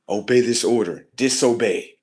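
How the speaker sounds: very loud mock orders; acted commands. Showing how he defies the Matrix, loud commanding.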